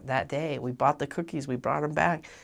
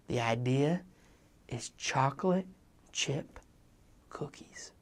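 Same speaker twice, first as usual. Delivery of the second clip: whispered reveal. Retold or imitated. Missionary revealing the genius plan, whispered. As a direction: whisper the last word like a secret. Big reveal of secret idea.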